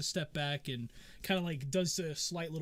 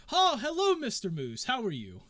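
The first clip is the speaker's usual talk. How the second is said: high, loud hello settling to usual pitch; excited greeting; 6 semitones higher, 6 dB louder than usual. Acted. Friend greets Mister Moose at the door, excited. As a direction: greet him with a big, high burst on the hello.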